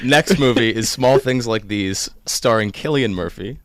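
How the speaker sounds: ordinary talk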